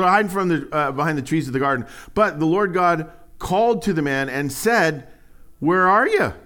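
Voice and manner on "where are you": calling out. Acted. God calling out to hidden man.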